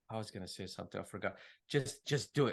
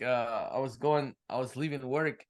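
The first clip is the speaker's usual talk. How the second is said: usual speaking voice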